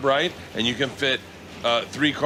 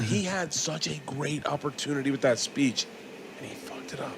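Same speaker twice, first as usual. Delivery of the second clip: fading to a whisper; 8 dB quieter than usual. Real, not acted. Disappointed aside.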